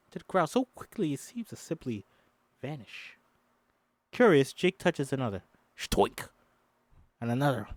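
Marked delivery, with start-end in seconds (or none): 5.8-6.3 airy popping shtoink; mock plant pop. Reading the script, voicing the plants popping. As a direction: spit out a cartoon pop.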